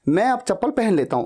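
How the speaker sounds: usual speaking voice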